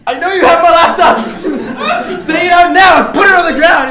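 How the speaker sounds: very high, loud yelled demand breaking into laughter; laughing at his own yelling; laughing shout. Retold or imitated. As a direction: yell after him across the street. Chasing thief, yelling demand.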